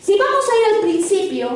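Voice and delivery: usual speaking voice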